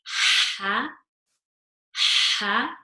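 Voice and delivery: ha pushed out loud with lots of air; airy ha. Shown as a demonstration. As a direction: breathe ha out from the mid throat. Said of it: "Lots of, lots of air".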